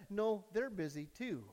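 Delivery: soft, slow little refusal; hushed small voice; small child's voice. Acted. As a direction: answer slowly and quietly as a child. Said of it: "silent voice said".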